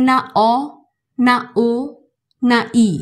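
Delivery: অ, ও, ই contrasted for the French e; not অ, not ও, not ই; French e sound. Shown as a demonstration. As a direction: show what the French e is not. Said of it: "মুখ খুলে কিন্তু proper কোনো sound করছে না".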